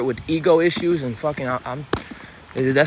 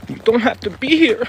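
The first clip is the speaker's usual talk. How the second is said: high and pointed; 7 semitones higher than usual. Real, not acted. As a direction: pitch it much higher. Repeat of line 9, pitched up.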